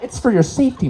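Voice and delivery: chuckling official reassurance; mock official. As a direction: say it brightly, half laughing. First spoken take.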